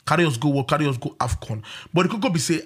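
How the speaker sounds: normal talking voice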